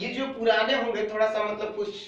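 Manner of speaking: ordinary delivery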